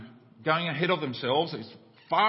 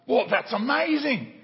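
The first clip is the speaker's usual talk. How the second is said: amazed stranger; 8 semitones higher, 5 dB louder than usual. Retold or imitated. Stranger on the bridge marvelling at the match, higher and louder. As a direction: marvel at the coincidence.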